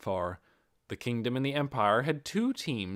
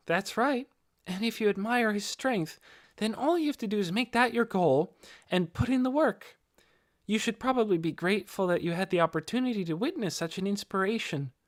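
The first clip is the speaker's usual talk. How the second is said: put-on much higher, gentle encouraging young voice; Ninya's voice; 9 semitones higher than usual. A narrator's reading. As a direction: lift it up and encourage him kindly.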